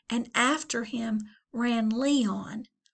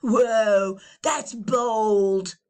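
loud drawn whoa; cool teen impressed; 8 dB louder, much slower than usual. Acted. Biggest teen, loud and cool. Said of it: "said the biggest teen".

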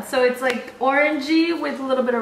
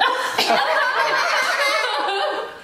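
laughing; 11 semitones higher than usual. Real, not acted. Disgusting bean reaction.

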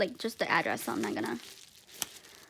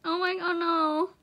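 overwhelmed, near tears; 5 semitones higher, slower than usual. Real, not acted. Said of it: "I'm gonna cry".

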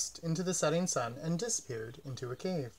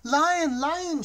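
high, bright bird voice calling out; little bird calling; 12 semitones higher, 7 dB louder than usual. A narrator's reading. Little red bird calling to the lion, high and eager.

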